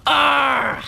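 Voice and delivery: loud, high mock roar; playful battle roar; pretend battle cry. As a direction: roar like a kid playing war. Child pretends to fight machines.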